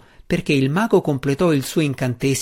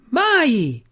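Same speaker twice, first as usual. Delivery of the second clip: high, shrill-pitched refusal; shrieking wizard; 12 semitones higher, 3 dB louder than usual. A narrator's reading. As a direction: squeal the word in defiance.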